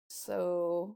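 ordinary talk, nothing marked

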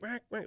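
high, quick duck quacks by mouth; imitated alarm quack. Shown as a demonstration. Duck caller showing the host a hen's alarm quack, imitated quacking. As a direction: mimic a startled hen mallard.

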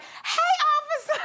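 very high, giggly hello to an officer; exaggerated sing-out flirt voice; flirty greeting. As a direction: coo it up high, then dissolve into giggles. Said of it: "and I was like".

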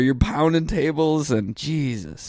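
talking with headphones cut out; temporarily deafened. Real, not acted. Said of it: "I can't hear anything anymore".

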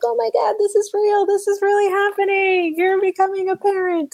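high, gushing, amazed new-parent voice; mock amazed gush. Friend imagining the moment of becoming a parent, high and amazed. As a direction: squeal it like it just hit you. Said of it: "you're like".

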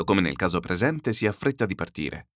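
plain reading voice

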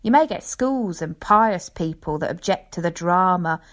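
ordinary delivery

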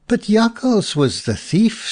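put-on high-pitched, mildly indignant child's objection. A narrator's reading. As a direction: object like an indignant little boy. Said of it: "said little Jan indignantly".

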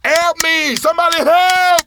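very high, loud drawn-out cries; frantic full-throated holler; screaming for help. Acted. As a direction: yell as far as the voice will carry. Handcuffed, screaming for rescue.

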